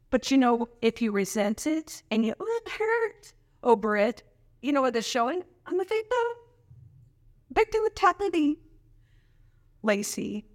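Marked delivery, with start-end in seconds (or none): 2.4-3.3 mock whine. Mocking victim complaint. 5.7-8.6 mock victim whine. Mocking victim voice.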